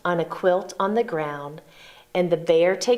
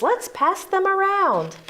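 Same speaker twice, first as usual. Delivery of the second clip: high squeaky offer; 11 semitones higher than usual. Acted. Gopher and Mole voiced.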